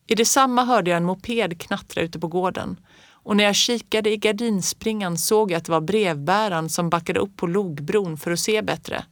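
ordinary delivery, nothing marked

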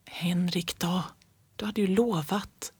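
soft unvoiced whisper; mother whispering to father, reproachful. A narrator's reading. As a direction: whisper a reproach across the table. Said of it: "hörde jag mamma viska".

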